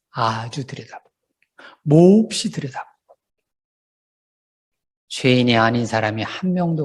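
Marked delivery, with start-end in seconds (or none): 0.1-2.9 hushed whisper. Acted. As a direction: drop to a hush as the list builds. Escalating repetition drops to whisper.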